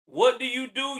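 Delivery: ordinary talk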